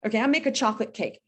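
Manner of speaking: ordinary talk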